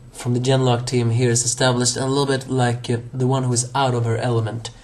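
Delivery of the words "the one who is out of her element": ordinary delivery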